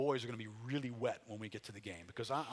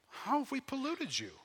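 much higher, incredulous comeback; protesting people; 13 semitones higher, 4 dB louder than usual. Acted. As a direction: play the people questioning God, pitched way up. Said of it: "But you say".

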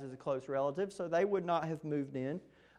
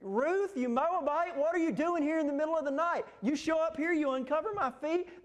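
high-pitched mock outrage, slightly louder; pitched-up pretend scolding at midnight; imagined screaming; 9 semitones higher, 3 dB louder than usual. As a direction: play an imagined humiliated Boaz scolding Ruth. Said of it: "started screaming".

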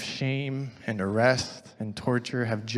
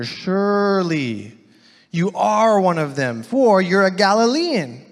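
insistent accusation; 6 semitones higher, 8 dB louder than usual. Acted. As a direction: press the charge harder with each word. Bystanders accuse Peter.

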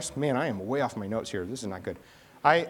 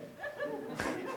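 high laugh; 12 dB quieter than usual. Real, not acted. Pastor laughs at her reply.

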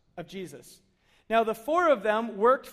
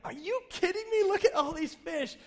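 much higher, thrilled disbelief; amazed excitement; 8 semitones higher than usual. Retold or imitated. Disciples hauling in a huge catch, thrilled and amazed. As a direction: shout it out like you can't believe the haul. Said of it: "pulling in the fish saying, like".

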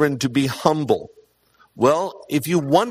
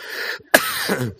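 real laughter; 8 semitones higher than usual. Real, not acted. Laughs at son's prayer story.

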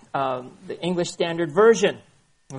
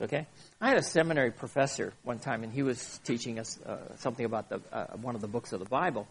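ordinary delivery

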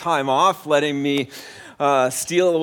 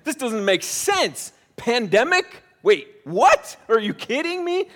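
rising into much higher, louder incredulity; mock disbelief; 7 semitones higher, 5 dB louder than usual. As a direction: play it growing ever more incredulous. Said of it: "to express".

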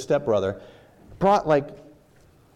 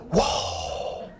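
awed whisper; 4 dB quieter than usual. Retold or imitated. Tempted by something on screen.

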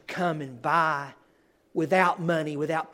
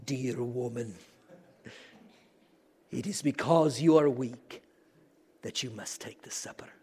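put-on weighty Scottish accent, pitched down; deep Scottish accent; 4 semitones lower, 4 dB quieter than usual. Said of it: "in his Scottish accent and with that deep voice".

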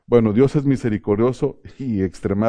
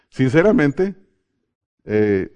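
normal talking voice

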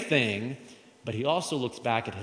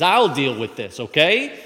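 bold declaration; 3 semitones higher, 8 dB louder than usual. Acted. David volunteers to fight.

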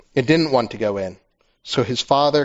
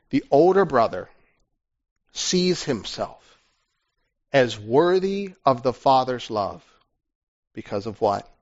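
ordinary delivery

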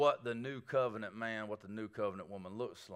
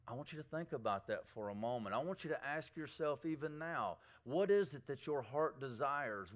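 ordinary talk, nothing marked

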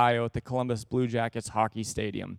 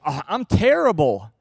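honest complaint; 2 semitones higher, 7 dB louder than usual. Retold or imitated. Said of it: "somebody goes".

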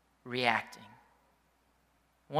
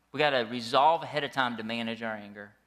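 ordinary talk, nothing marked

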